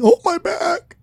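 put-on high creaky old man complaint; old man groan. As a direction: whine like a frail old man. Imagining opponent as old man.

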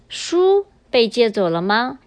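书 stressed and held, short pause after; stress on first word. Shown as a demonstration. Teacher demonstrates emphasizing the key word, stressing 书 then pausing. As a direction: set 书 apart with a little pause. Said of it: "we emphasize the first word".

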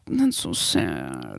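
very low, slow mock-pitying drawl; exaggerated deep sad voice. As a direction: sink the voice into fake sorrow.

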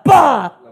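loud, explosive "pa!"; sudden impact "pa". Retold or imitated. Cuenta una sorpresa brusca en el sendero. (¡pa! explosivo y fuerte)

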